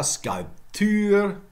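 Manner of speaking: "sculpture" as skulp-TÜR, final stress; French-style pronunciation. Shown as a demonstration. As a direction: say "sculpture" stretched on the ending. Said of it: "more examples".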